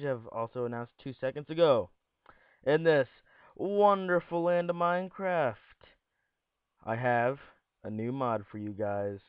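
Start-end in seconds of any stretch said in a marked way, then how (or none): none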